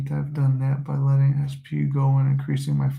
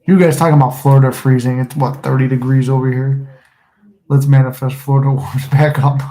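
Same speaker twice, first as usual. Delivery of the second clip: loud excited; 7 dB louder than usual. Real, not acted. Loud excited reading of chat.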